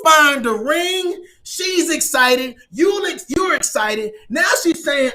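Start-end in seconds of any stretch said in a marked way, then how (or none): none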